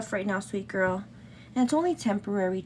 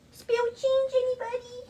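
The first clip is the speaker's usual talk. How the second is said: sing-song call. Real, not acted. Asking chat again for change, high lilting call. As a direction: lilt it up high, fishing for coins.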